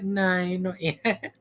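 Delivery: ordinary talk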